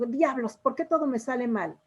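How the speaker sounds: ordinary delivery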